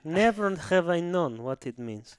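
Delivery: hammed-up Russian coach; bad Russian accent. New coach introducing his nickname, mock Russian accent. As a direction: put on a solemn, badly faked Russian accent. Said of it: "the worst Russian accent".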